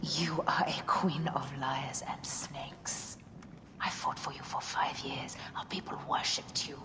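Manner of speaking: soft, tense whisper; bitter whisper. Acted. Betrayed wife to Cleopatra, bitter whisper. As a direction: hiss it at her quietly.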